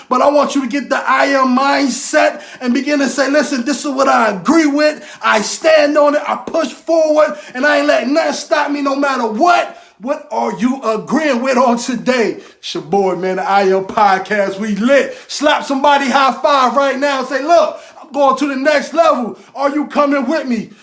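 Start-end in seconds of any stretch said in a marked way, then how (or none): 0.1-9.8 raised, higher, driving sermon voice; fired-up motivational preaching; preaching at full force. Acted. Preaching the I am mindset. 15.3-20.7 raised, higher, driving sermon voice; fired-up motivational preaching; preaching at full force. Acted. Hyping the listeners up.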